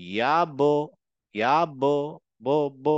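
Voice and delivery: "ইয়াব" with ব echoing as "bo"; bouncing qalqalah. Shown as a demonstration. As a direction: bounce off the ব in "ইয়াব", then repeat that bounce alone. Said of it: "এরকম একটা bouncing হচ্ছে".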